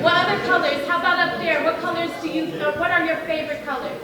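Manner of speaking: loud and high, asking the audience; calling out to crowd. Real, not acted. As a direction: call across the room for answers. Asks audience for favorite colors.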